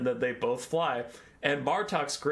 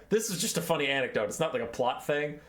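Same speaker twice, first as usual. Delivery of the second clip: normal talking voice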